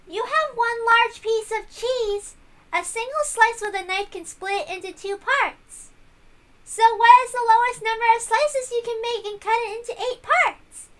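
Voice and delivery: a hint of a small girl posing a riddle; lightly voiced little girl. Little [name] reads out riddle.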